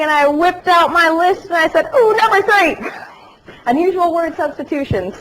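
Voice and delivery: high, loud, laughing punchline. Real, not acted. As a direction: deliver the gag bursting with laughter. Pulling out list, amused.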